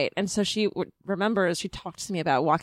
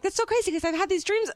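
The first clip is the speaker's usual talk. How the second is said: amazed; 13 semitones higher than usual. Retold or imitated. Dream self amazed.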